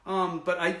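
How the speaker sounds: usual speaking voice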